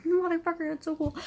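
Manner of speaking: fan's delighted curse; excited swear. Retold or imitated. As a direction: blurt it out in awe. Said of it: "and I'm like".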